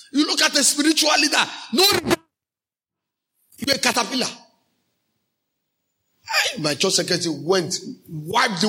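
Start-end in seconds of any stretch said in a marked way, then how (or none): 0.1-4.3 preaching shout. Real, not acted. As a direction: thunder it at the congregation. Preacher rebuking disrespect.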